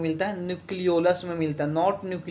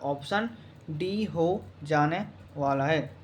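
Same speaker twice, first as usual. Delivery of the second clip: word by word with pauses, "option ... D ... हो ... जाने ... वाला है"; slow, spaced-out repeat; 4 dB quieter, slower than usual. Real, not acted. Teacher confirming the answer to students, slow and measured. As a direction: dictate it slowly, softening as you go.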